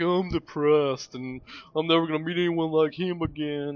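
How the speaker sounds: mock Tobey Maguire in a whiny put-on accent. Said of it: "why I gave him that accent".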